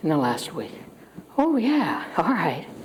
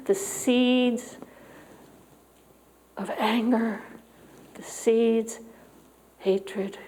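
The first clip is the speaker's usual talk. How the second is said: guided prayer; 3 semitones higher, slower than usual. Acted. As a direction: lead them gently into prayer. Leads breathing and prayer.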